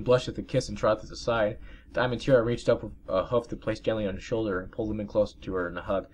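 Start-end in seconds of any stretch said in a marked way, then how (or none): none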